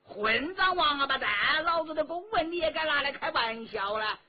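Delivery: officer cursing the dwarf. A narrator's reading. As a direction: swear at him. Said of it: "军官骂着".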